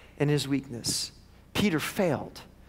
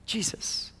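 breathless hush on one word; hushed denial; whispered name; 5 semitones higher, 7 dB quieter than usual. Acted. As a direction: let it fall in a whisper.

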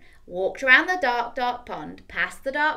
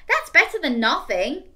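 little skeleton; 5 semitones higher, 8 dB louder than usual. Acted.

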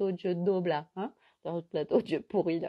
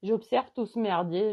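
ordinary talk, nothing marked

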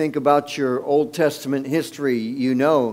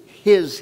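"his" hit harder and louder; "his" said firmly, like a preacher underlining it; stress on his; 5 semitones higher, 5 dB louder than usual. Shown as a demonstration. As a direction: punch the word "his" to make the point. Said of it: "I put the emphasis on the word his".

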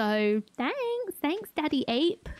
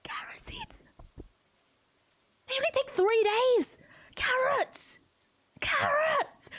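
much higher, thrilled squeal; squealing excitement; 11 semitones higher than usual. Real, not acted. Player finding carrot seeds squeals with joy. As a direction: squeal it like a dream come true.

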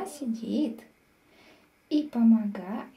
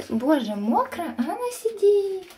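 exasperated; 6 semitones higher, 6 dB louder than usual. Real, not acted. Exasperated at cat.

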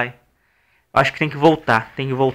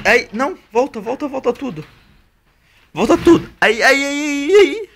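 frantic excited; 10 semitones higher than usual. Real, not acted. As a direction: panic as it slips. Frantic directing of a push.

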